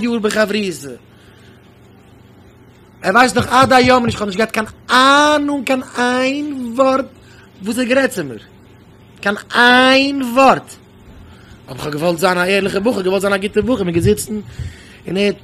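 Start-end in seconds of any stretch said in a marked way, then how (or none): 4.5-7.1 "kein ein Wort" drawn out very slowly, pitched high; "kein ein Wort" held long on a raised pitch; emphatic stretching. Real, not acted. Stressing he knew not one word. 9.2-10.7 "Kein ein Wort" slow and drawn out; "Kein ein Wort" stretched out with emphatic force; emphatic stretching. Real, not acted. Repeats the point with emphasis.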